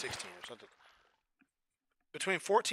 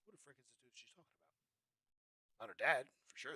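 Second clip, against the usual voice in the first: usual speaking voice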